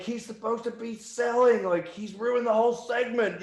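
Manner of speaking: high loud outraged complaint; furious rant. Retold or imitated. Booker Jeff complaining to Indian management, furious high rant. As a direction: keep ranting like the angry boss. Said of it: "Jeff just turned around and went".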